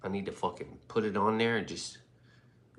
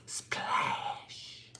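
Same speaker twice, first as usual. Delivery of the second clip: whispered splash; 7 dB quieter than usual. Pretend. Sound effect for magic.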